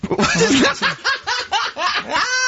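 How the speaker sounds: shrill burst of laughter; high-pitched laugh. Real, not acted. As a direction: squeal of laughter. Steve laughs at Karl.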